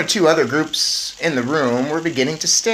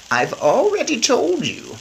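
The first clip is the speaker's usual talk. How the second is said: grandmother's voice; 11 semitones higher, 3 dB louder than usual. Acted. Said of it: "my grandmother said".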